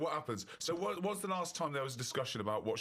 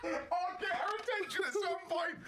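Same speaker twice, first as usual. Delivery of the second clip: high, slow words squeezed out through laughter; laughing through words; slower than usual. Real, not acted. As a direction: say it while you can't stop laughing. Repeating line while laughing.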